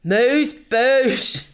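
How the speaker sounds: hammed-up teasing echo; mock-posh echo. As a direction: stretch out AMUSE BOUCHE grandly. Mockingly echoes the French term.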